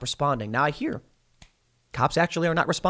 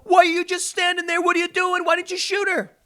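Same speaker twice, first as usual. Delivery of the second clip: mocking critics. Mocking critics if cop did nothing.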